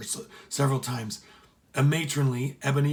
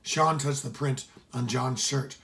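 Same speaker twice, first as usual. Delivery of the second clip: usual speaking voice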